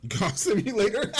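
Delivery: high, loud incredulous question; amused disbelief. Real, not acted. Host echoing a ridiculous asset, laughing disbelief. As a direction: repeat it way up high through a laugh. Amused repeat of absurd item.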